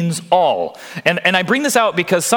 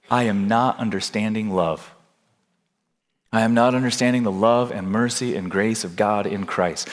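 ordinary talk, nothing marked

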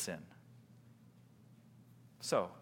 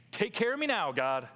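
higher mock-demanding plea; impatient plea; 10 semitones higher, 6 dB louder than usual. Impatient believer asking God for a quick fix, mock demanding. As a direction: hurry God along, pitched up.

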